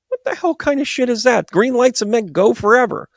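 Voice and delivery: high-pitched indignant protest; mock outrage. Driver told green no longer means go, in acted outrage.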